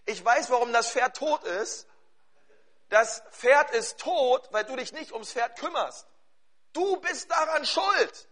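high, emphatic verdict from the pulpit; accusing preaching. Acted. Preaches an accusing example at force.

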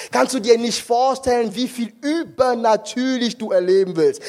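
ordinary delivery

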